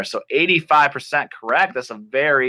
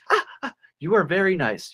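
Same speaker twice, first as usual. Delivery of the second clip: lightly voiced girl, pitched up; a touch of naive delight; charmed girl; 7 semitones higher, faster than usual. Acts the girl answering the wolf.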